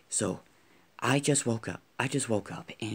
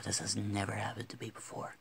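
usual speaking voice

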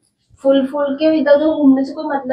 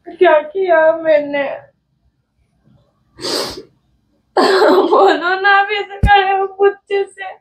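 high tearful pleading, slow and broken; crying; 3 semitones higher, slower than usual. Real, not acted. Wife begging the silent husband to talk, crying. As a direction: sob out the plea to him. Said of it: "तो रो क्यों रही है तू?".

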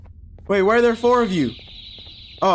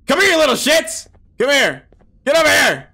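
loud, high-pitched angry yell; frustrated shout; 6 semitones higher, 4 dB louder than usual. Real, not acted. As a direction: holler at runaways to get back. Chasing birds in frustrating game.

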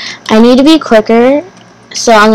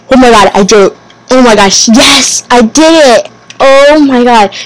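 joyful relief; 3 semitones higher, 3 dB louder than usual. Real, not acted. Succeeds after long struggle.

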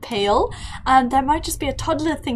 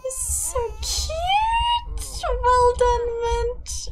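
cooing delight; 11 semitones higher, slower than usual. Real, not acted. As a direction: gush over a newborn. Cooing over the newborn.

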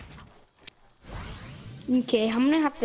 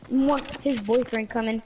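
ordinary talk, nothing marked

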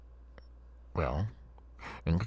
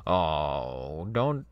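ordinary talk, nothing marked

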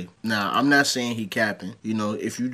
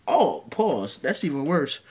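ordinary talk

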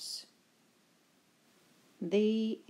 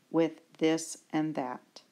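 ordinary delivery

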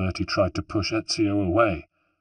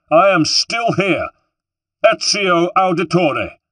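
high, loud public declaration; proud proclamation; 10 semitones higher, 12 dB louder than usual. A narrator's reading. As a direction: announce it to the whole crowd. Ezio proclaims vengeance to guests.

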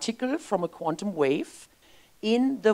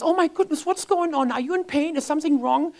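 put-on high, alarmed mother checking on a crying child; worried mom; 10 semitones higher than usual. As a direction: mom voiced higher, fussing over her kid. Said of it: "And she says".

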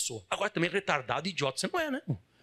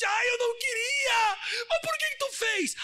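high, hammed-up wail; mock crying; slower than usual. Scorpion wailing to the dying turtle, mock crying. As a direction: bawl it high like a fake crybaby. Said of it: "o escorpião começou a chorar e disse".

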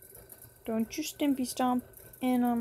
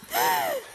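very high startled gasp; scared gasp. Real, not acted. Player gets a jump scare, high gasp. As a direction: gasp as if jumped at. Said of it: "That scared the shit out of me".

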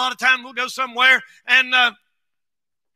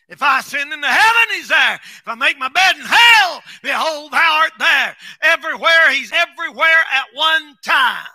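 preaching shout; 3 semitones higher than usual. Real, not acted. Preacher shouting Psalm verse to the congregation. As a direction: proclaim it to the rafters. Scripture preached at full force.

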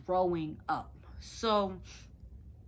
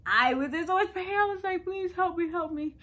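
much higher, desperate begging; pitched-up retold plea for help; pleading in pain; 10 semitones higher, 4 dB louder than usual. Reliving pleading in labor pain.

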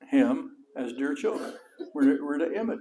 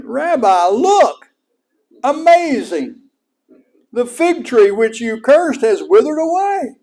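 much higher, loud astonished cry; amazed; 8 semitones higher, 11 dB louder than usual. Retold or imitated. Peter showing Jesus the withered fig tree, loud and amazed. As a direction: Peter gasping in wonder at the tree. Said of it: "he shouldn't have been amazed".

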